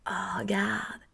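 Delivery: hushed, breathy admiration; soft wonderstruck whisper; awed whisper. Acted. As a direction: whisper it as if marvelling at someone. Acts admiring what others have.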